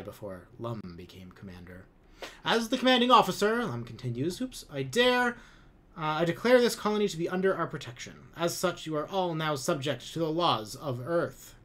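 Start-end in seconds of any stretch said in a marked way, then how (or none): 2.4-3.6 much higher, louder grand declaration; pompous commander. A narrator's reading. Lum declares authority. 4.4-11.6 higher, louder, fast pronouncement with a slip; pompous commander. A narrator's reading. Lum stumbles, then declares.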